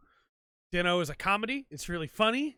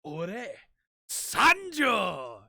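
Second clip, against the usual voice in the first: hero's catchphrase; 2 semitones higher, 5 dB louder, much slower than usual. Retold or imitated. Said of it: "he goes".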